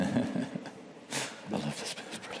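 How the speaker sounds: hushed words under the breath; whispered aside. Real, not acted. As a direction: whisper it to a colleague. Aside to a colleague during the game.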